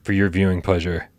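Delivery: high, slow show-open announcer read; announcer intro. Acted. Host opening the live show, announcer style.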